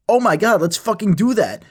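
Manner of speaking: high-pitched, thrilled outburst; acted burst of eager excitement; excited agreement. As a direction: jump on the idea with sudden glee. Friend seizes on the lying idea.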